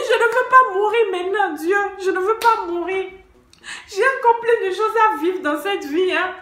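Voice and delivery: high, loud desperate shouting prayer; crying out. Retold or imitated. Woman threatened with a knife screams to God for help. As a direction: yell it to heaven, begging. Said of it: "J'ai commencé à crier".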